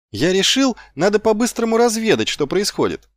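put-on high-pitched, eager young recruit; gallant. A narrator's reading. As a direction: report it briskly and boldly. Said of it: "браво произнёс Маркоу".

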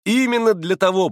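high, loud, guileless reply; artless, candid shout. A narrator's reading. Boy admitting why he stayed away, naive cry. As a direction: shout it with simple-hearted frankness. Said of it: "прокричал простодушнейшим образом Коля".

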